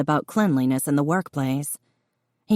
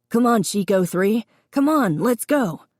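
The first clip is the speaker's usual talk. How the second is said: calling out; 5 semitones higher than usual. A narrator's reading. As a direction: call the dog brightly. Calling the dog.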